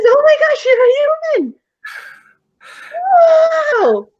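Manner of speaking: put-on very high, loud kid's amazement; amazed ghost boy. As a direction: squeal it like an astonished child. Ghost boy amazed to see human.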